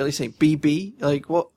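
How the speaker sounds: ordinary talk, nothing marked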